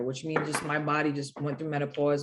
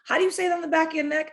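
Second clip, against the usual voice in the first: quoted cornerman; 11 semitones higher, 5 dB louder, much faster than usual. Retold or imitated. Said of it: "he said".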